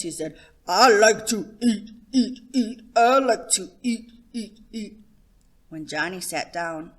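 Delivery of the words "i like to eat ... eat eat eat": a boy's mock deep voice, much louder. A narrator's reading. Johnny trotting to the table in a mock deep, loud voice. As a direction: boom it out like a big man. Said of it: "In a deep voice".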